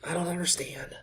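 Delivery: whispered, breathless disbelief; defeated whisper. Real, not acted. Manager whispering in despair after another miss. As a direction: breathe it out, beaten.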